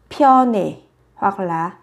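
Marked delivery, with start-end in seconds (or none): 0.1-0.7 pyone ending in an "e" vowel. Shown as a demonstration. Teacher repeating the first pronunciation for learners, pyone ending in "e". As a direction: finish pyone on a clear "neh". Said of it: "biến đổi ươi thành e".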